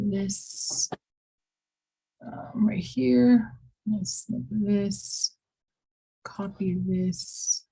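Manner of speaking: slow, half-whispered to herself; whispered aside. Real, not acted. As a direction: mutter it under your breath while working. Teacher muttering while clipping screen.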